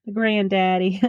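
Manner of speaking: put-on drawled southern accent. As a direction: say it like a Texan granddad. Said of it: "That was my southern accent".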